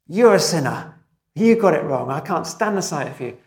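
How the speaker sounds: loud, harsh and accusing; harsh accusing outburst. Shown as a demonstration. Preacher showing the wrong way to confront someone, loud harsh accusation. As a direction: snap at them as if condemning them. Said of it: "Instead of saying".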